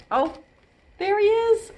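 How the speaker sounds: usual speaking voice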